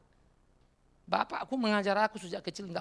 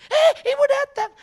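very high, very loud imitated cry of alarm; mock alarm; 14 dB louder than usual. Staff warning that the boss arrived, shouted mock alarm. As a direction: shriek a warning to the office, hammed up.

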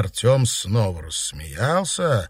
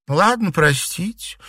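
slow, high placating tone; apologetic; 8 semitones higher, slower than usual. A narrator's reading. Girl backing down after provoking a quarrel, apologetic. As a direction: give in with a drawn-out apology. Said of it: "стала оправдываться Серафима".

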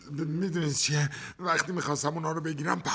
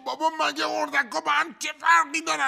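indignant shout; 10 semitones higher than usual. Acted. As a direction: shout back in protest, higher. Husband argues with wife.

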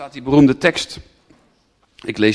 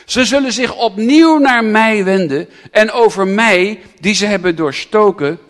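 louder, higher sermon reading; preacher declaring Zechariah to the congregation; proclaiming scripture; 7 semitones higher, 9 dB louder than usual. Acted. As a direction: read it out with force.

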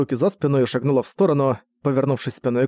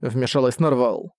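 ordinary delivery, nothing marked

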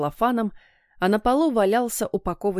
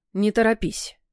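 plain reading voice

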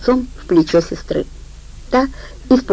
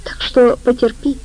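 ordinary delivery

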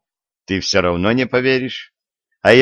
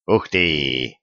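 staunend; 6 semitones lower, 4 dB quieter than usual. A narrator's reading. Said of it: "ахнул он".

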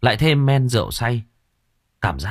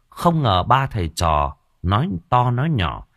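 ordinary delivery

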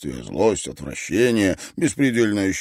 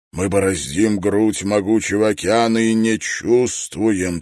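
solemn, swelling public prayer, pitched up; heartfelt prayer; 3 semitones higher, 6 dB louder than usual. A narrator's reading. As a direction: pray with longing from the depths of the soul. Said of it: "Его голос звучал проникновенно".

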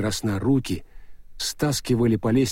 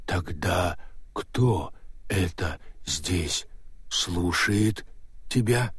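slow stone monotone; 4 semitones lower, 5 dB quieter, much slower than usual. Acted. Golem ghost asks.

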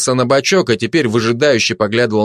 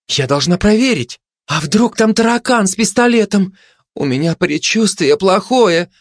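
anxious; 8 semitones higher than usual. Acted. Anxious dogs voicing fears.

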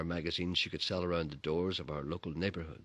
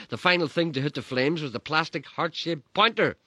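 louder, higher breathless telling; excited narration; 6 semitones higher, 10 dB louder than usual. A narrator's reading. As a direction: tell it swept up. Narration swept into frenzy.

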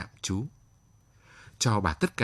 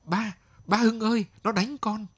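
much higher, panicked cry for help; girl screaming to her father while being beaten, much higher and frantic; 11 semitones higher than usual. A narrator's reading. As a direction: scream for your father as you're struck. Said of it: "Cô gái la lên".

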